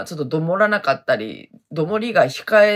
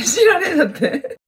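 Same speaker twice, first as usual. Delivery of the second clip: higher, laughing repeat; 10 semitones higher than usual. Shown as a demonstration. (笑いながら繰り返す)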